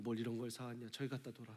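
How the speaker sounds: quiet, dismissive murmur; reserved Korean elder. Retold or imitated. Korean parent receiving a gift, a quiet shrug-off. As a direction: brush off the gift modestly. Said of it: "준비되고 훈련된 게".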